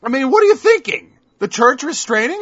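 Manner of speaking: normal talking voice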